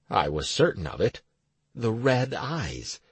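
plain reading voice

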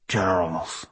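unhurried, low Welsh lilt; slow lilting Welsh voice; Welsh accent. Acted. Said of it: "his unhurried voice carrying a Welsh accent".

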